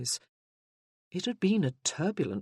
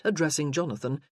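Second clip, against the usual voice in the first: usual speaking voice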